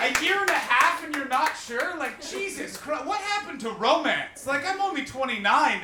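high, loud comic disbelief; high, exasperated outburst; incredulous rant. Real, not acted. Host berates couple for being unsure.

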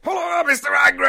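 hammed-up bellowed hello; mock radio greeting. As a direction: imitate a zany host hailing a regular caller. Host and crew greeting the character.

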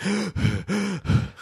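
high sharp gasp of dismay; shocked gasp. Retold or imitated. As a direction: gasp as if your heart sank. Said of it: "I was like".

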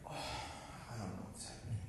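unvoiced, soft, helpless; panicked whisper. Counselor at a loss at camp's end, whispering helplessly. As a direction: whisper it like you're lost and overwhelmed.

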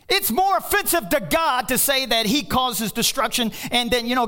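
loud, high preaching; fired-up preacher; preaching at full force. Real, not acted. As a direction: rail against false teaching.

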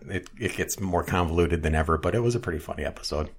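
ordinary talk

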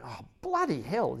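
slow, drawn out, fed up; exasperated groan. Retold or imitated. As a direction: sigh it out in disbelief. Exasperated at the full container.

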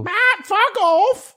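very high, drawn-out British squawk; British parrot. Acted. Parrot cursing its rescuer in a high British squawk. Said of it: "learn to talk from British people".

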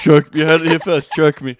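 loud, punchy proclamation; gleeful mock announcement. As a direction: announce it loudly like breaking news. Gleefully announcing partner's revealing answer.

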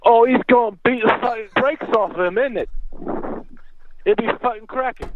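badly faked British accent. Shown as a demonstration. Caller giving a fight prediction on request, bad British accent. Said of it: "in a British accent, and go".

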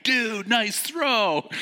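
high excited cheer, slowed; impressed cheer. Retold or imitated. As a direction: cheer the great throw. Rafters cheer the throw.

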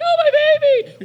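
high, slow, loud panicked wail; mock mother's scream. Imagined mother screaming for her baby, mock shriek. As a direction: shriek it like a frantic mom. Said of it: "Some lady's gonna be like".

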